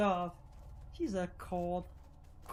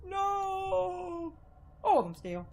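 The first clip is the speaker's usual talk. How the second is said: high, pained cries of a man held down; terrified screams; 9 semitones higher, much slower than usual. Acted. Lerv pinned by his friends, screaming in fear. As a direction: scream it out in terror.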